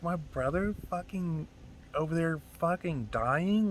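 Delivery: normal talking voice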